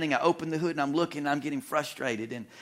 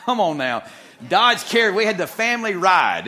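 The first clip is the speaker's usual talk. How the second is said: mock proud; 4 semitones higher, 7 dB louder than usual. Joking about the family minivan.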